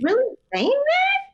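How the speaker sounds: high, slow disbelieving quote; incredulous. Retold or imitated. Viewer reacting to Lauren's mom in disbelief. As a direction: play shocked outrage at the dinner guest.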